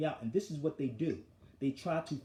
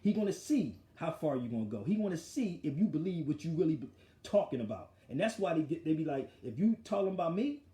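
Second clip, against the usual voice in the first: normal talking voice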